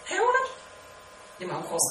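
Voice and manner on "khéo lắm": very high, rising praise; exclaimed praise. Acted. Teacher mimicking praise for a clever person, very high exclaimed praise.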